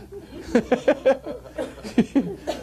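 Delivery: a high, breathy burst of laughter at his own joke. Real, not acted. Preacher laughing at his own joke about drink, high laugh.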